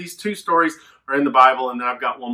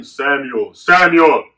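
booming echoed voice calling the name; echoing call; 8 dB louder, much slower than usual. Pretend. God calling the boy at night with a louder echo. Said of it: "You hear the echo?".

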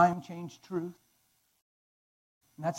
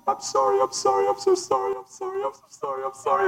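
much higher, pleading mock-sobbing sorry; imitating tearful wife. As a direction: mimic his wife's repeated crying apology. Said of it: "She was going".

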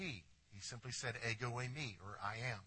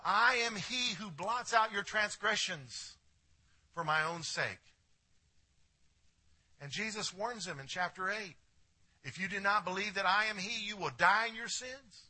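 preaching loud; 7 semitones higher, 7 dB louder than usual. Real, not acted. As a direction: proclaim it to the whole church. Preacher proclaims the verse loudly.

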